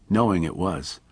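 plain reading voice

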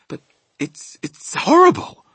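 high stammer bursting into loud outcry; falter, then cry out high in horror; horrified cry. A narrator's reading. Sophie recoils from the dream, high horrified cry. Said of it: "Sophie cried".

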